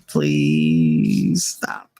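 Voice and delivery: very low, drawn-out weary plea; exasperated pleading. Retold or imitated. Worn-out mother begging her son to quit, exasperated. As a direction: stretch out PLEASE, sunk low in the voice.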